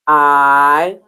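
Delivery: "I" drawn out like the word eye, low; long I sound. Shown as a demonstration. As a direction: hold a long low "I" that rises at the end. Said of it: "long I sound".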